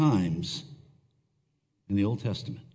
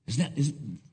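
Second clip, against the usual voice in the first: ordinary talk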